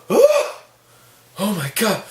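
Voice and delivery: high gasped "oh", hammed-up horror; mock freak-out. Old lady reacting to a wisp of vapor, mock freak-out. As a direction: overplay an old lady's shocked panic. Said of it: "she starts freaking out".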